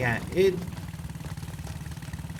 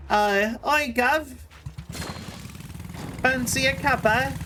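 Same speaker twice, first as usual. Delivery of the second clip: hammed-up, higher-pitched British accent; clumsy mock-British accent with a higher lilt; bad British accent; 6 semitones higher than usual. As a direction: do a cheesy, failed British accent. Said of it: "when I did my British accent".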